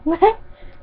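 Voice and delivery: high gloating laugh; villain laugh. Acted. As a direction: cackle like a pleased villain. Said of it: "the man said in a Scottish accent".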